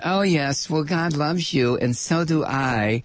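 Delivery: a hint of a syrupy churchgoer; understated sanctimonious parody; mock pious believer. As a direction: lightly voice a smug smiling believer. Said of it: "the smiling, uh, religious person".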